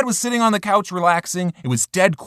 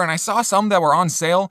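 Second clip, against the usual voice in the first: ordinary talk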